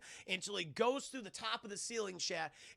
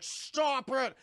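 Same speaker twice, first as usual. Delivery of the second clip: loud, higher retold shout; mock yell; 6 semitones higher, 7 dB louder than usual. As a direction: yell it like a cartoon villain. Retold cartoon villain yelling.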